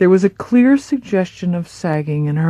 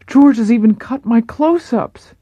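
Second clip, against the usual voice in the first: higher, louder wounded complaint; upset Marilyn; 7 semitones higher, 6 dB louder than usual. Acted. Marilyn telling Paula about the cuts, high and hurt. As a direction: let the hurt ring out, pitched up.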